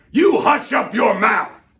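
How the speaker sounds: high, very loud slow furious shout; howled. Acted. The mighty king howling Mac into silence. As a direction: howl him down. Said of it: "howled the mighty King Yertle".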